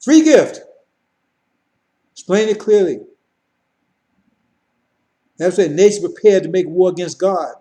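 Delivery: loud, driving sermon delivery; preaching at full force. Acted. Preacher warning the congregation about Farrakhan, loud and driving. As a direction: hammer it home to the congregation.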